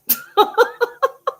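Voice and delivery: cracking up at her own lawn story, loud high laugh; high burst of laughter. Real, not acted.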